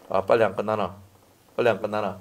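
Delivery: low, acted grumbling under the breath; impatient grumble. Bored congregant watching the clock during scolding, low impatient grumble. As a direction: grumble like someone stuck in a sermon.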